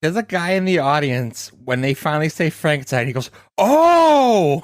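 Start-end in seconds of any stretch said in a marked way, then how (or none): 3.6-4.6 sudden loud high "Oh!" of realization; surprised shout. Retold or imitated. Audience member suddenly getting the joke, loud surprised shout. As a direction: shout it out like it finally clicked. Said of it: "You had to hear it".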